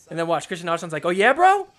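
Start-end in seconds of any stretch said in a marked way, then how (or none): 1.1-1.7 louder, higher, rising taunt; mock challenge. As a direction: square up to a rival, pitch climbing. Acted player challenges his rival.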